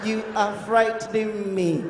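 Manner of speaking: put-on soft, low, slow girlfriend voice; girl calming down. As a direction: soothe him slowly, hurt and quiet. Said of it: "the girl voice go come calm down".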